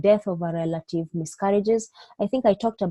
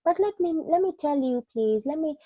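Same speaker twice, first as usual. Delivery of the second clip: pleading and insisting, higher in pitch; the wrong way, pushing back at a demanding patient; arguing back; 6 semitones higher than usual. Shown as a demonstration. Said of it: "you can make them into an angry patient".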